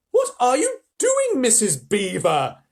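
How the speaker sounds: put-on very high, slow surprised girl; exclaiming. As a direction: gasp it out in surprise. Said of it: "exclaimed Susan".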